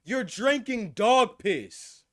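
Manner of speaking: slow, loud, high disbelief; shocked disbelief. Real, not acted. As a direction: drag it out in shock. Reacting in disbelief to clip.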